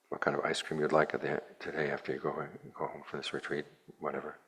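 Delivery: usual speaking voice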